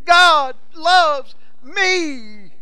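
very high, loud and very slow yell; child's joyful yell. Retold or imitated. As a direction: stretch out the yell like a thrilled little girl. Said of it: "It had her little cross saying".

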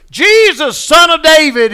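loud, high-pitched desperate call; crying out. Acted. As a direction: call out to be heard over everyone. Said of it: "he cried".

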